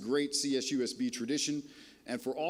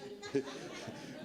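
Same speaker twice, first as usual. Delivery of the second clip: breathy laugh; 8 dB quieter than usual. Real, not acted. Laughs at own joke.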